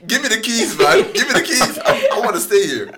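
high, loud, laughing plea; giddy, high-pitched play-acted insistence; excited mock demand. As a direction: beg eagerly for the keys, hammed up. Acted eager newcomer demanding the keys.

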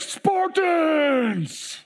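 high, overhyped Spartans call; dramatic announcer shout. Retold or imitated. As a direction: blast it like a hype man on a mic. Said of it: "Like, really dramatic".

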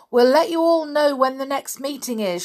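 loud, high call; shouting. A narrator's reading. Peter shouting to friends as they part. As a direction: call it across the corner. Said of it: "shouted Peter".

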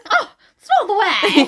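high, loud mimicked yelp; mock yell. Co-host copying Sam's protest, exaggerated yell. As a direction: wail it, imitating the poked boy.

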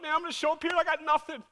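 mock panic, high and loud; retold freak-out. Pastor with nothing for Sunday, mock panicking. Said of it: "I'm, like, freaking out".

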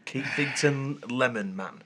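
drawn-out mock name. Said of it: "mock name drawn out".

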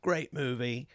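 lightly put-on British accent; a touch of amateur British. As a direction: read it in a mock British voice. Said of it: "I'm doing the same accent again".

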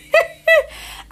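high loud laugh; real laugh. Real, not acted. Laughing at friend's comment.

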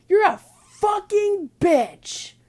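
drawn-out, high-pitched furious shout; hammed-up screamed insult; road-rage yell. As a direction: yell it slowly and hard at another driver. Acted shouting at another driver.

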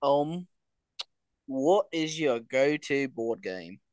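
slow, careful mock British; British accent. Reading the next question in the challenge, slow mock British. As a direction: ask slowly in put-on British. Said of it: "we have to talk in a British accent".

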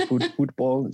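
bad British accent. American guest copying host's British "football", poor British accent. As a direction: put on an unconvincing British accent. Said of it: "That is not a good British accent".

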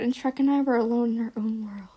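fast hushed romantic whisper. Acted. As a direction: breathe it like a secret. Dreamy romantic narration.

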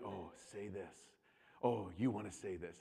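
low, hushed devilish coaxing; inner rage tempting. Retold or imitated. Rage inside urging son to say this, tempting. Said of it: "that rage inside me".

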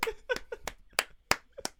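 breathy high laugh. Real, not acted. Laughing at the Applegate shout out.

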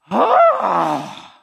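loud, exasperated sighing groan; hammed-up groan of frustration. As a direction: heave out a big fed-up "ahh". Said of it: "This is just an exasperated".

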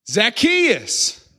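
high, drawn-out call; calling out a name. Acted. Jesus calls to a man in a tree, calling out high. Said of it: "he calls him by name".

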